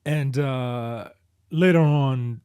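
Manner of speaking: normal talking voice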